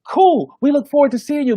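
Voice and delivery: a high, bright "cool"; excited caller. Retold or imitated. Station caller signing off the call, eager and high.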